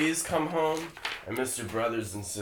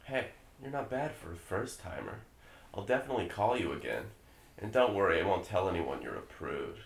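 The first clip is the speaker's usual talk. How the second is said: soft, low, smug sneer; a quiet, slimy predator; creepy predator voice; 4 semitones lower, 7 dB quieter, faster than usual. Acted. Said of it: "[name], why don't you be Dan".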